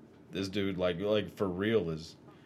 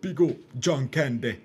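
loud, slow, higher mock Jamaican; Jamaican accent; 7 semitones higher, 9 dB louder than usual. Mimicking Tom Hanks' son to friends, hammed-up Jamaican accent. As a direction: ham up a Jamaican accent loudly. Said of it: "him talking like this".

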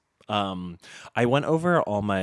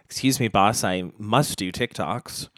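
mock pleading employee; 2 semitones higher, 7 dB louder than usual.

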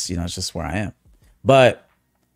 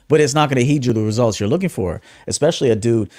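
normal talking voice

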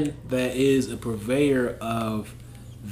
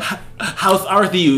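mock biblical lisp; 5 semitones higher than usual.

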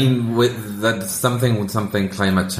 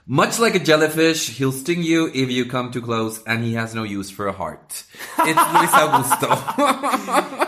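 host introduction; 4 semitones higher than usual. Pretend.

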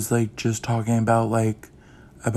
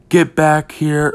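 retold manager's yell, higher and louder; slow, raised-voice imitation of a scream; mock yell; 4 semitones higher, 5 dB louder, slower than usual. Manager calling her worker back to the window, mock yell. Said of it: "was screaming at him".

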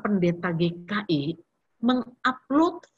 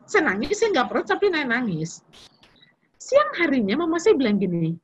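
usual speaking voice

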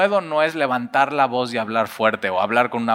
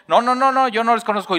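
much higher, louder, near-shouted no's; frantic denial; 9 semitones higher, 3 dB louder than usual. Acted. Peter denying again, frantic and much higher. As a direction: deny it desperately, voice cracking up high.

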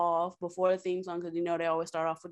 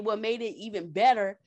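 ordinary talk

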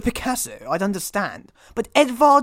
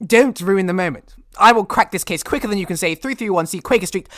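ordinary delivery

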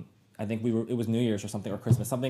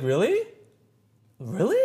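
high, louder stunned double-take; surprised mom; 9 semitones higher, 7 dB louder, slower than usual. Retold or imitated. Mom hearing her son come out, surprised and higher. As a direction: echo mom's disbelief, pitched up.

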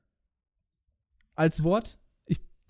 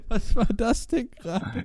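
laughing; 6 semitones higher, slower than usual. Real, not acted. Laughs after grunting while laughing.

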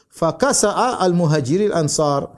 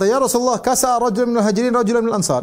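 high, eager report of a fight; reporting urgently; 8 semitones higher than usual. Retold or imitated. Companions hurry to tell the Prophet of the fight, high and urgent. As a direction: rush the news to the Prophet. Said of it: "Maka mereka berkata".

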